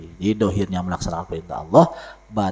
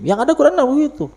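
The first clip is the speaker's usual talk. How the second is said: fast emphatic; 7 dB louder, much faster than usual. Real, not acted. Fast high insistence.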